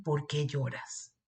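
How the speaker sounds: softly and slowly, as if consoling; a quiet, caring why are you crying; soft tender question. Acted. Angels' tender question retold.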